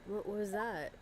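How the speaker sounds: usual speaking voice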